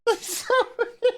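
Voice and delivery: high, squeaky helpless laugh. Real, not acted. Reviewer cracking up at the show, high helpless laughter.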